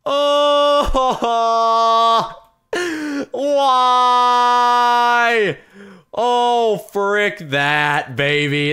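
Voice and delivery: high, loud drawn-out wail; anguished outburst. Real, not acted. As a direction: cry out at rotten luck, stretching the vowels. Big reaction to case result.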